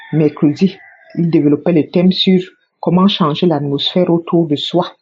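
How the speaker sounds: ordinary talk, nothing marked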